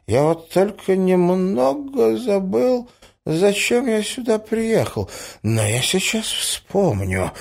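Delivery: put-on muddled old prince. A narrator's reading. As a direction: fret helplessly and lose the thread. Acts the poor muddled prince. (бедный князь, растерянно, с паузами)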